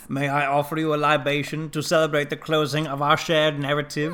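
a hint of Werner Herzog's measured accent; highfalutin Herzog quote. Quoting Herzog's libation line to the cohost, in a lightly put-on formal accent. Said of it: "The libation one?".

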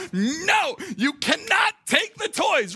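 put-on a loud, high shout at the toddler, slowed down; stern shout. Father shouting at his two-year-old son over a toy, a loud high stern yell. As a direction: lay down the law with a loud, high, slow yell. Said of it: "administer the hammer of justice to my son".

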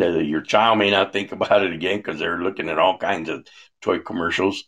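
normal talking voice

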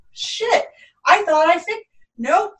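mock caught-off-guard outburst; dismayed realization. Host voices someone facing an unwelcome thing again, dismayed.

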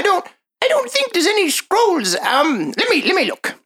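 high, put-on gnome shopkeeper; squeaky-high gnome merchant.

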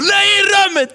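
very high-pitched mock tantrum shriek; screaming child. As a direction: imitate a kid shrieking a refusal. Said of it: "skrek och skrek och skrek".

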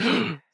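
shocked intake of breath on seeing Cindy; sharp high gasp. Retold or imitated. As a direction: gasp in sudden alarm. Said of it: "he sees Cindy and he's like".

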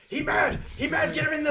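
high, loud squeaky creature yelling; high loud goblin-ish alarm call; kobold voice. Acted. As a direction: squawk like a frantic little kobold.

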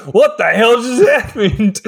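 high-pitched confused outburst with a laugh; mock bewildered. Random guy baffled after being kissed, confused. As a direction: play a baffled bystander, half laughing. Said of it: "the dude is so confused".

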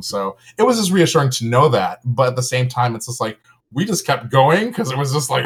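usual speaking voice

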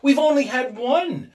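high, loud insistence; rapid, eager reply. A narrator's reading. Wife urges husband about remaining wishes, excited. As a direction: press the point excitedly. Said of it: "she replied rapidly".

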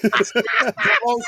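mock Pelosi. Mocking Pelosi drawn out.

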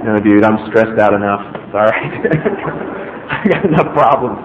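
lightly voiced teen brushing off an invite; mock teen brush-off. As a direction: play a dismissive teenager, lightly. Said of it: "It's like".

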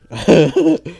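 real laugh; high laugh. Real, not acted. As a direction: laugh at your own joke. Laughs at own bit.

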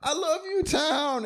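very high, hammed-up holiday cheer; giddy movie hero imitation; elated George. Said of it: "and he's like".

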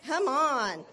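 ordinary talk

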